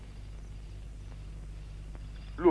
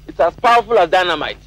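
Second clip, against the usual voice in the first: loud boast; 3 semitones higher, 7 dB louder than usual. Acted. Boasting about his dynamite foot.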